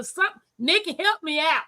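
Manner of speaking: normal talking voice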